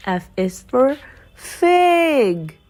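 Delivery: plain reading voice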